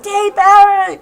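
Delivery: high, very loud reenacted yell. Churchgoer calling an elder who's so deaf, loud scream. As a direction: yell the name at someone who can't hear. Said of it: "so deaf. Like, I had to be like".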